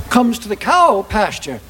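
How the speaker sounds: plain reading voice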